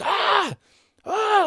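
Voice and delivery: very high shouted yelps; random yelling. Retold or imitated. Students yelling in the street near a little boy, high loud yelps. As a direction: yelp like rowdy students. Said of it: "they kept shouting".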